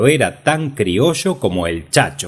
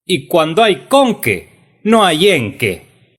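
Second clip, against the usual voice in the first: "con qué" y "en qué" dichos CÓN-que, ÉN-que; acento en CON y EN; 9 semitones higher, 4 dB louder than usual. Acted. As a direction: tono alto, golpea "con" y "en", no "qué". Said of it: "pongan el acento en la O". ("con qué" y "en qué" con la primera sílaba tónica)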